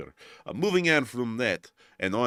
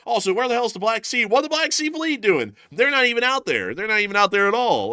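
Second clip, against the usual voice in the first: high, louder mock outrage; incredulous rant; 10 semitones higher, 6 dB louder than usual. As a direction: demand it in raised, incredulous voice. Mock rant about absent fleet.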